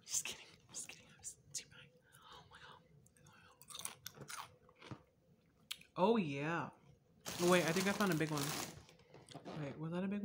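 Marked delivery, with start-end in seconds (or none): 0.1-5.0 very soft, breath-only retraction; whispered aside. Real, not acted. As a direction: whisper it under your breath. Taking back the curse, aside.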